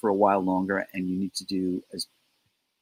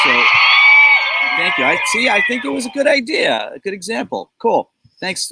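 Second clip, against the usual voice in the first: high mock approval; 12 semitones higher, 8 dB louder than usual. Voicing Linda's approving reaction.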